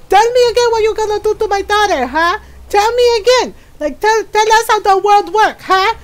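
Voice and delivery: high, loud and fast furious taunt; a mother yelling at her drunk brother-in-law; furious mom yelling. Retold or imitated. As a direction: scream it at him, daring him.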